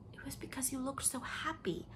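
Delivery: high whispered confession; pained whisper. Acted. Leslie confessing envy to Anne, whispered. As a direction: whisper the painful truth.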